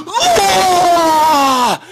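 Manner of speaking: very high, very loud roar of rage; hammed-up monster scream in close-up; villain battle scream. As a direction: scream it full out like an angry warlord. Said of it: "he pulls a, like".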